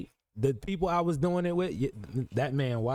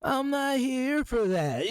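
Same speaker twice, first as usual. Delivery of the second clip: slow, higher mock of the chat's denial; mocking quote; 8 semitones higher, slower than usual. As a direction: parody them saying it, drawn out. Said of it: "You said".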